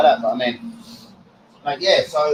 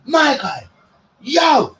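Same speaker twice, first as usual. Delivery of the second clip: higher, louder imitated shout across the street; mock shout; 9 semitones higher, 3 dB louder, much slower than usual. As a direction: call out big tunes like a stranger. Retells being called out loudly.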